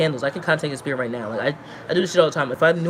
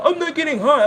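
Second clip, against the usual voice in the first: mocking the roommate; 9 semitones higher, 3 dB louder than usual.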